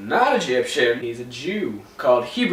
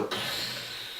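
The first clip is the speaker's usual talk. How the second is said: frog splat sound; 14 dB quieter than usual. Retold or imitated. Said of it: "the frogs go".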